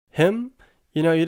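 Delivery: high, loud disbelief; can't believe she means him; incredulous. Acted. Daughter answering her father about Shrek, shocked and incredulous.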